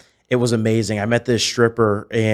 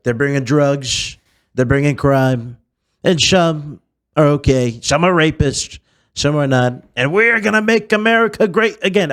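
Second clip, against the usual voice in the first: slow, loud, higher campaign-speech impression; Donald Trump; 5 semitones higher, 5 dB louder than usual. Candidate announcing his run on TV, slow Trump oratory. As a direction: declaim it as the politician, drawn-out words. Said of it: "and he's like".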